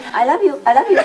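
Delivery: high, squawky parrot voice, laughing through it; talking parrot. Retold or imitated. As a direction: imitate a pet bird chirping the words. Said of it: "they speak English even".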